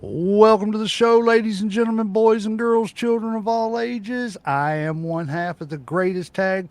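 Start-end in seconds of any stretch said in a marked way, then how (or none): 0.0-4.3 loud ring announcer welcome; grand entrance announcement. Acted.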